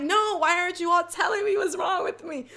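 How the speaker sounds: high, loud hammed-up wail; half-laughing shouted plea; mock desperate protest. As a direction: cry out in exaggerated frustration. Acting out desperate reply to friends.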